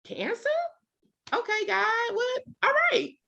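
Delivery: high, soft, a little stunned; mock happy-go-lucky. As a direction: play it bright and half-shaken. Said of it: "happy-go-lucky Tamika".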